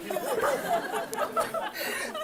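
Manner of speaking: high, soft imitated sobbing; quiet high-pitched pretend weeping; mock child crying. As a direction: imitate a little girl's thin whimper. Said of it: "she starts crying".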